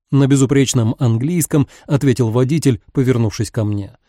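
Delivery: ordinary delivery, nothing marked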